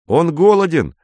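Paul jumps in, glad to stir things up; perked-up eager remark. A narrator's reading. Boy suddenly eager to join the teasing. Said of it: "оживившись, заявил Поль".

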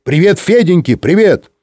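high, cheery shouted hello; eager high-pitched holler; calling out. A narrator's reading. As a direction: call a greeting from the next room. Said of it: "крикнул из штурманской".

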